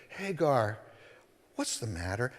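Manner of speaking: soft, gentle, no edge at all; toned-down question. Shown as a demonstration. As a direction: ask it softly, smoothing it over. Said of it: "tamp that down a little bit".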